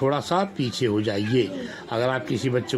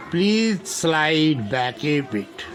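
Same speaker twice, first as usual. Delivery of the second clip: slow teaching read; much slower than usual. Shown as a demonstration. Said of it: "इसको बोलते हैं".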